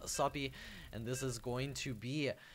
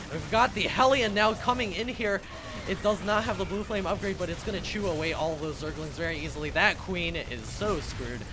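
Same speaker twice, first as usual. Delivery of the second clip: loud, high, fast hype call; excited play-by-play; 4 semitones higher, 8 dB louder than usual. Real, not acted. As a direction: sell the big moment. Hype as Hellion attacks.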